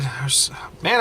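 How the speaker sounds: ordinary delivery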